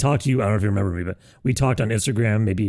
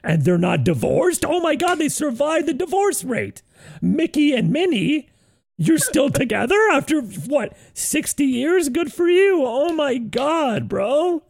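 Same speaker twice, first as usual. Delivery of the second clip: mocking Disney fan; 11 semitones higher than usual. Host mocks cohost gushing about Mickey and Minnie, high voice. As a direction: coo over Mickey and Minnie, far higher. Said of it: "he's going".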